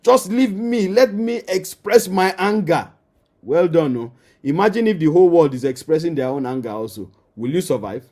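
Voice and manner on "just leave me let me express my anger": higher-pitched imitation of an indignant spouse; mock angry protest. Preacher mimicking an angry spouse rebuffing advice, mildly indignant. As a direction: act out someone refusing to calm down. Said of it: "This is your anger control".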